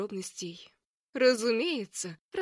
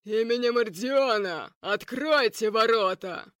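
louder, commanding booming call; 7 dB louder than usual. Acted. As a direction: bellow it from outside the gates. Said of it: "разносился зычный голос".

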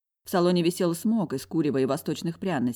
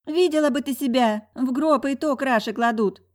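lively higher voice, pushing her tipsy friend; bossy friend, scornful; 5 semitones higher than usual. Acted. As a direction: boss your friend around with brash energy. Бойкая подруга командует. (бойкая подруга, напористо и свысока)

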